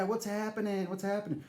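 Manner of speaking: high drawled greeting; lazy high repeat of the catchphrase; Isaac's voice. Retold or imitated. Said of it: "he's like".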